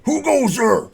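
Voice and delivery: high, loud challenge from an unseen voice; calling out. Acted. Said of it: "you hear a voice call out".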